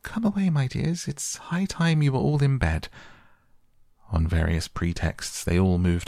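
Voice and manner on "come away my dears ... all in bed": put-on high, trembling call to her children. A narrator's reading. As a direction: call out high with a quiver of fear. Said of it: "called out in a trembling voice".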